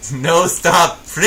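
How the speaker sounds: playful escalating yell; mock command shouts. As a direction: bark out joke voice commands, building to the last. Performs the game's voice commands.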